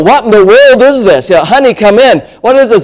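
high, hammed-up parental bewilderment; baffled parent. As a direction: call your spouse over in mock amazement. Bewildered parent calling spouse over.

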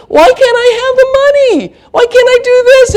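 hammed-up teenage boy squealing very high; soprano whining. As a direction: whine in a piercing mock soprano. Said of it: "now they're singing soprano".

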